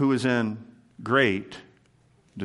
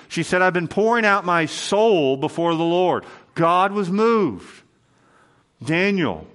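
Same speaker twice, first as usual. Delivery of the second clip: louder, higher preaching with punched lines; fervent preaching; 4 semitones higher, 4 dB louder than usual. Acted. Pastor driving home to the church that God was moved, fervent preaching. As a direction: preach it out, building with each example.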